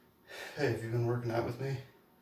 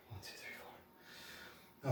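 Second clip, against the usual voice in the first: whispered counting; 2 semitones higher, 16 dB quieter, much slower than usual. Real, not acted. Counting reps under heavy strain.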